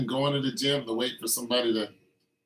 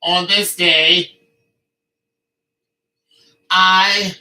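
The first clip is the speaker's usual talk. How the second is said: slow, loud, grand herald decree; loud mock-archaic decree; mock royal proclamation; 3 semitones higher, 7 dB louder, slower than usual. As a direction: proclaim it like a pompous queen.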